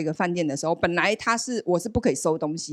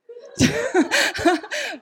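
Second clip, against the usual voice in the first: long high laugh that falls away; real laughter. Real, not acted. Laughing as she tells of mom's news about dad's back. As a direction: laugh at the irony of it. Mom says dad hurt back.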